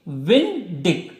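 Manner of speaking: विंडिक stretched slowly, both syllables clear; slow drawn out. Shown as a demonstration. Teacher slowly repeating the part to memorise. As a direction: let the word sink in slowly. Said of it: "कितनी याद रखनी है?".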